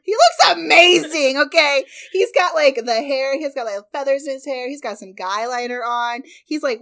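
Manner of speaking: loud, high, emphatic enthusiasm; excited gushing. Real, not acted. Host defending her favorite band member's look, loudly gushing. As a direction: gush insistently, well above normal volume.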